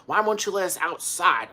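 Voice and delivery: high, pestering mock complaint; demanding cats. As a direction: nag the owner like impatient cats. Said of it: "they were like".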